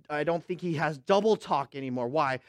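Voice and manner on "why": ordinary talk, nothing marked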